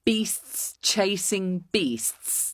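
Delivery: very slow and soft, each word separated; slow, deliberate narrator take; careful retake. Shown as a demonstration. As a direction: pick through a tongue twister with care. Laboured retake of tongue twister.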